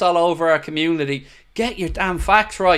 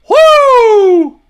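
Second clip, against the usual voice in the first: high ecstatic Ric Flair woo, much louder; 11 dB louder than usual. Real, not acted. Fan celebrating a goal with a loud high woo. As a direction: scream it like a wrestler.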